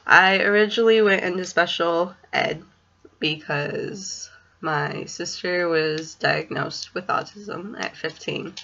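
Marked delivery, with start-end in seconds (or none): none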